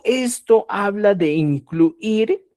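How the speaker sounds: usual speaking voice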